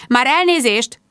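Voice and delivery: high, indignant interjection; bristling. A narrator's reading. Woman talked over by two men, bristling protest. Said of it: "borzoltam a sertéimet".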